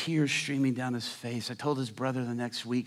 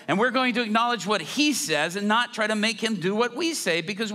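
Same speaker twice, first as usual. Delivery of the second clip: loud, higher impassioned preaching; preaching at full force; 7 semitones higher, 5 dB louder than usual. Acted. Preacher rousing the congregation, loud and higher. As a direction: build it up and drive the point home.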